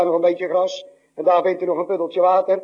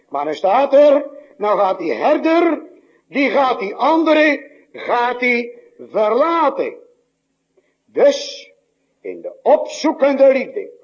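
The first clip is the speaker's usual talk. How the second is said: fervent preaching; 8 semitones higher, 5 dB louder, slower than usual. Acted. Preacher swells on the shepherd.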